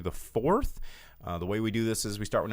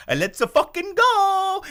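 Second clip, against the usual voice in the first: put-on high, loud, slow Italian Mario accent; Mario catchphrase; 10 dB louder than usual. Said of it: "he legitimately talked like Mario".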